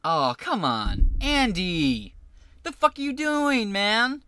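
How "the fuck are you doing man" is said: shocked disbelief. Real, not acted. Reacting to lookalike.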